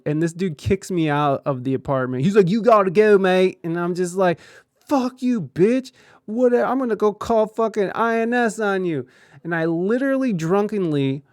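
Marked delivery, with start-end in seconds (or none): 2.5-3.6 loud, higher mock British order; British roommate. British roommate throwing the drunk boyfriend out, mock British. 4.9-9.1 high, loud threatening yell; drunken yell. Retold or imitated. Drunk boyfriend threatening the roommate with immigration, loud yell.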